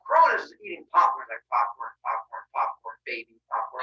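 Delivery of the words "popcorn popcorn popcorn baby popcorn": low monotone chant; oblivious muncher. Acted. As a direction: drone it absentmindedly, low and even. Cronus munching, absorbed, oblivious.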